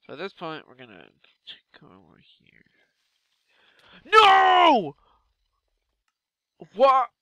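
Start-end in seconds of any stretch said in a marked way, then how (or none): 4.0-5.0 high, loud cry of dismay; real shocked yell; anguished shout. Real, not acted. Character dies suddenly. 6.7-7.1 high, loud disbelief; can't believe what just happened; real shocked outburst; stunned what. Real, not acted. Disbelief after dying.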